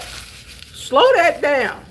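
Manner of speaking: dragging slow that down. Retold or imitated. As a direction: stretch every word of slow that down.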